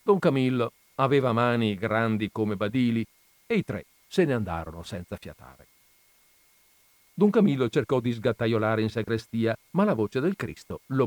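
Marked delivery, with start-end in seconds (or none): none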